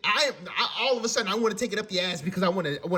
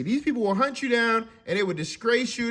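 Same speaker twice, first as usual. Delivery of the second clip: ordinary talk, nothing marked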